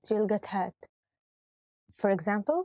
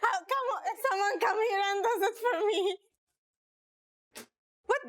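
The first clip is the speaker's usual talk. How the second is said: laughing; 10 semitones higher than usual. Real, not acted. Laughing bloopers, high giggly voice.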